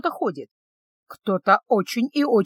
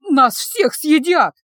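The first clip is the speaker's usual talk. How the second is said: higher, louder, slow hysterical cry; hysterical squeak; 5 semitones higher, 6 dB louder, much slower than usual. A narrator's reading. Someone panics that all will be eaten, hysterical squeak. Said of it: "пискнул кто-то истерическим голосом".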